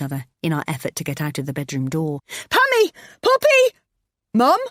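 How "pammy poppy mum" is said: very high, loud desperate calls; calling out. Acted. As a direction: call out across the house. Calling out through the house.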